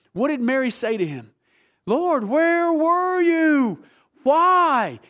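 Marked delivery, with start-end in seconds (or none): 1.9-5.0 high, loud, slowly drawn-out cry of grief; anguished plea. Acted. Preacher voicing Mary grieving to Jesus, anguished loud plea. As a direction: wail it like a heartbroken sister. Mary's grief voiced in sermon.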